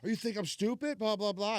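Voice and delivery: high-pitched mock yell, not very loud; retold angry scream. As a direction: act out yelling in someone's face, half-hearted. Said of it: "I start screaming at him in his face".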